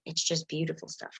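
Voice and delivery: normal talking voice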